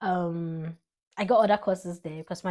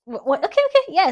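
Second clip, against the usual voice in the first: flustered; 13 semitones higher, 12 dB louder than usual. Real, not acted. Flustered restart.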